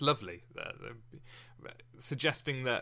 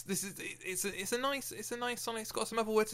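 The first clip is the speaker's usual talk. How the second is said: ordinary talk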